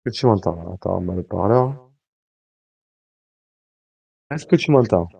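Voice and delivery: distant voice through a phone speaker; phone held away. Real, not acted. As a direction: speak from far off the mic. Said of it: "On, on l'entend de loin". (voix lointaine au téléphone)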